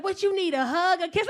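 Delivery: high, loud offer of comfort; imitated boyfriend mid-argument. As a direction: imitate him offering affection over an argument. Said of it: "We be arguing. He be like".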